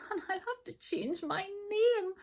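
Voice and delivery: high, tearful voice; sobbing. A narrator's reading. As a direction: say it on the edge of sobbing. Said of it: "she sobbed".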